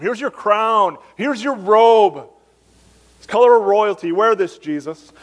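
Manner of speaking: mocking soldiers offering crown and robe; soldiers' mockery. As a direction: sneer it like a soldier dressing up a prisoner. Soldiers mock Jesus.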